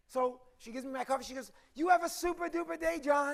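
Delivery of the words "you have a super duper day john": high-pitched, gushing send-off; hammed-up perky clerk voice; over-caffeinated cheer. Counter clerk sending a customer off, absurdly chirpy. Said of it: "she had too much coffee".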